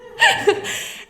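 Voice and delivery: laugh. Real, not acted. Laughing at astrological map line.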